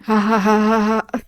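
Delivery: half-hearted spoken ha-ha; imitated polite laughter. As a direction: fake obliging chuckle. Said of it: "Oh, he's laughing".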